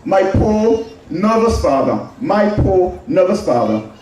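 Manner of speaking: usual speaking voice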